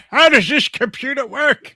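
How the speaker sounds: high, put-on old-timer voice; ninety-seven-year-old. Joking about streaming at ninety-seven, old-person voice. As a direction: play a baffled, very old computer user. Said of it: "when I'm ninety-seven".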